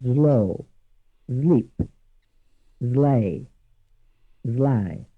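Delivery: Italian accent, S voiced to Z before L. Shown as a demonstration. Teacher demoing an Italian learner's English words, Italian accent. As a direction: zlo zlip zlej zlaj with an Italian Z for S. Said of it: "Listen again to the Italian pronunciation".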